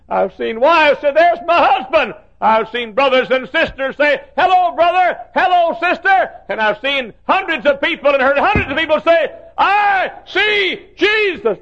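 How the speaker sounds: high, loud preaching rising to a slow shout; full-force preaching. Acted. Preacher telling of the saved greeting loved ones, fervent. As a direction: preach the reunions with fervour.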